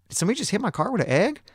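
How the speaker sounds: high, rapid-fire disbelief; incredulous question. Retold or imitated. Driver discovers his car was egged, high incredulous question. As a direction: blurt it out, pitch way up.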